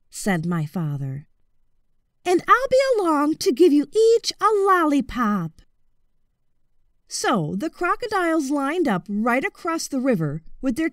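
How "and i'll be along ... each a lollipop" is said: put-on higher, polite boyish voice; high, courteous young voice; boy's high voice.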